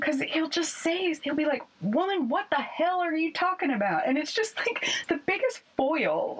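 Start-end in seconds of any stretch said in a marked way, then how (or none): 1.8-4.1 put-on southern accent. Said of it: "He's got this southern accent".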